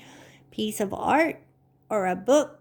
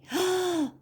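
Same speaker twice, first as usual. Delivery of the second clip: playful gasp; 10 semitones higher than usual. Pretend. Pretend surprise for kids.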